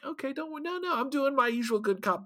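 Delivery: lightly acted high-voiced hushing; mock reassurance. Voicing a character's hurried reassurance.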